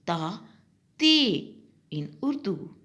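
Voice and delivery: ordinary delivery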